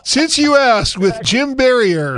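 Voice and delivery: high, loud, drawn-out show intro; mock show announcer. Co-host teasing guest about stealing his show, mock announcer. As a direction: announce it like a big show opening.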